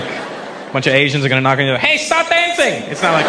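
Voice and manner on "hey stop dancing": mock raid shout.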